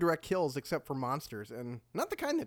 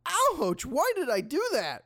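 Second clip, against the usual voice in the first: high cry of regret; pained yelp; 8 semitones higher, 3 dB louder than usual. Acted. Fighter who grabbed a blade, pained.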